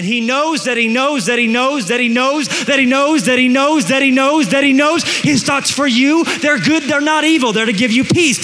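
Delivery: loud, high, driving repetition; full-force preaching. Real, not acted. Preacher insisting God's thoughts are good, loud impassioned climax. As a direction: belt it out relentlessly to the crowd.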